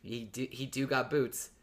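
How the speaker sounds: normal talking voice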